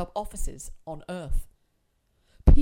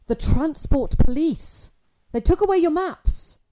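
loud alarmed; 8 semitones higher, 10 dB louder, slower than usual. A narrator's reading. Son reports police raid.

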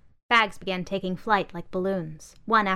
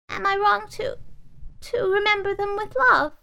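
high, pleading doll; 13 semitones higher, 4 dB louder than usual. Acted. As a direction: ask it tenderly, high. Doll speaks.

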